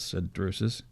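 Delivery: plain reading voice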